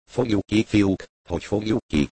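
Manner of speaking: ordinary delivery, nothing marked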